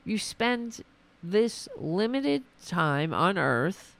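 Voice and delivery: usual speaking voice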